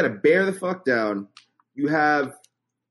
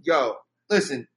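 normal talking voice

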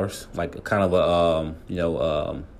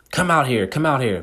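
higher-pitched, retold yelling at a closed door; angry calling out; 6 semitones higher than usual. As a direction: call him out, mock angry. Wife banging on trainer's door.

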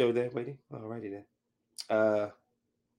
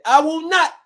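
raised, high, defiant voice; refusing to quieten; 9 semitones higher, 8 dB louder than usual. Retold or imitated. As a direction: hit it loud and high in refusal. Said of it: "lower your voice".

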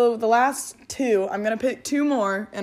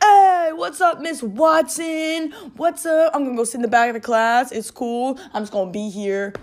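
much higher, louder shouted greeting, brash and cheerful; cocky class clown; 5 semitones higher, 5 dB louder than usual. Pretend. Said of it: "he'll be like".

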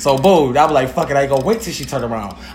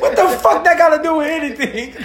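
mock protest; 12 semitones higher than usual. Retelling own retort in quarrel.

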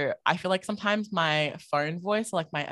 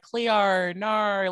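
higher, louder meme Australian vowels; memed Australian accent; 4 semitones higher, 4 dB louder than usual. Retold or imitated. Guest quotes the TikTok meme in exaggerated Australian accent. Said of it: "memed the Australian accent".

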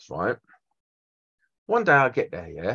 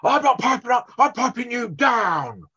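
mock site bully; 10 semitones higher than usual. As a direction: shout it like a pompous jobsworth. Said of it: "And he goes".

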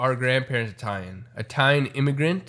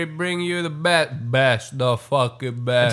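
vocal Italian; 4 semitones higher than usual. Acted. Showing how vocal Italians are, hammed-up Italian-American accent. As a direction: start high, stretch the last words in mock Italian-American. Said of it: "very vocally pronounced".

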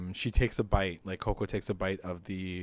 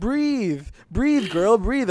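urging yell; 11 semitones higher, 6 dB louder, much slower than usual. Retold or imitated. Urging Coco while she chokes.

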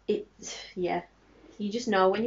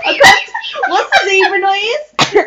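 excited shout; 11 semitones higher, 14 dB louder than usual. Real, not acted. Asking for the zebra noise.

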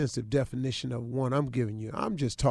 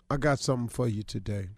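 usual speaking voice

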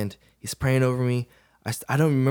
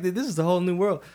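amazed; 9 semitones higher than usual. Retold or imitated. Awed after church experience.

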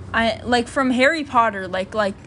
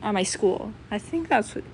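ordinary talk

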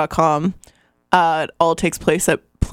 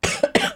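sudden sharp choking cough; real choking cough mid-sentence; 4 dB quieter than usual. Real, not acted.

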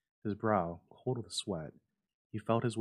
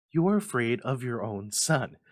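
loud, higher accusing cry; reproachful cry; 4 semitones higher, 10 dB louder than usual. A narrator's reading. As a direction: cry it out at him. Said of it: "she cried".

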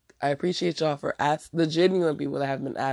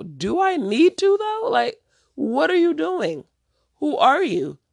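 much higher and louder, slow and incredulous; exasperated disbelief; 12 semitones higher, 4 dB louder, slower than usual. Real, not acted. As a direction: throw the question back in disbelief. Ranting at pushy would-be guests.

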